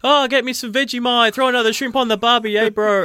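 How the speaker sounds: hammed-up ocker Aussie, high and loud; Australian accent. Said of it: "He's from Australia".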